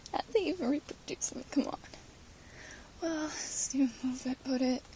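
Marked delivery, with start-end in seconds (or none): none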